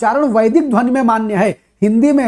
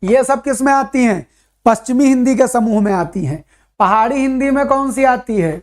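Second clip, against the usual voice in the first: each dialect name drawn out slowly with long pauses; slow dictation; much slower than usual. Acted. Teacher slowly dictating Hindi dialect groups to students. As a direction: spell it out slowly so students can write it down.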